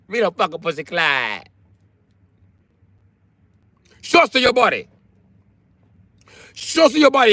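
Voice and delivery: high-pitched Jamaican patois rant; mock Jamaican patois. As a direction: imitate a scolding woman in Jamaican patois. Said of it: "speak patois like a Jamaican".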